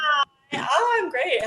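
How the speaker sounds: excited. Real, not acted. Cheerful greeting when added.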